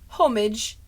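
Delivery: "Homage" with h and rounded vowel; British homage. Shown as a demonstration. Practising the British version, "Homage" as HOM-ij. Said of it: "British people say homage".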